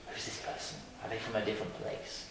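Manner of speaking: imitating a frightened local's whispered question; nervous whisper. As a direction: whisper it like a scared stranger peeking at a foreigner. Voicing scared, curious locals.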